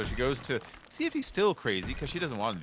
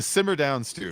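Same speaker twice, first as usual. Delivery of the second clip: mock scolding; 2 semitones higher, 6 dB louder than usual.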